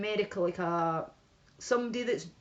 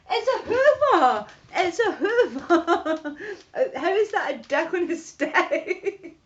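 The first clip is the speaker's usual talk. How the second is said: high, loud shriek through real laughter; laughing protest; 8 semitones higher, 7 dB louder, slower than usual. Real, not acted. Streamer protesting a viewer's filthy guess about her Hoover, laughing shout. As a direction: protest helplessly, cracking up on the words.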